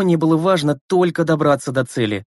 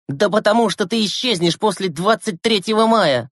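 yelling back; 7 semitones higher than usual. Acted. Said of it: "заорал в ответ Глеб".

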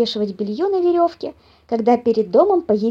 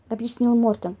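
usual speaking voice

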